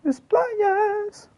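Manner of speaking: "Is playas" wailed way up in falsetto; wailing falsetto echo. Pretend. Singing to himself while gaming, wailing falsetto. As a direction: cry "playas" high like a backing singer.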